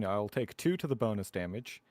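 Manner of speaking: ordinary delivery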